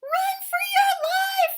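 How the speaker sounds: high, loud panicked shout; yell. Acted. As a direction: yell to flee. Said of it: "yelled Rabbit".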